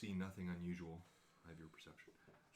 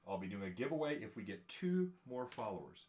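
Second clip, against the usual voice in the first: usual speaking voice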